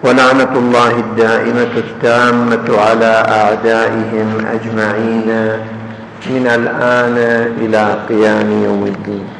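low, level recited Arabic blessing; measured, monotone prayer-like preamble; formal opening invocation. Acted. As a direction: intone the ritual opening formula on one low, steady note.

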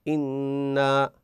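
إنا drawn slowly then a stop; pausing on إنا. Shown as a demonstration. Teacher demonstrating a stop on إنا, slow. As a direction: recite إنا and stop short. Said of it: "وأتوقف".

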